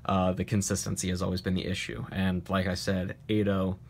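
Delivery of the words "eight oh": ordinary delivery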